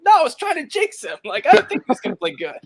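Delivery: high-pitched protest. Retold or imitated. Texting his buddies back about the jinx, high mock protest. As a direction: squeak out a comic, exasperated denial.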